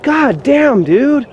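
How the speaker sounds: high, shocked God damn dude; shocked exclamation. Real, not acted. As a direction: gasp it out in disbelief. Sees how far clearing goes.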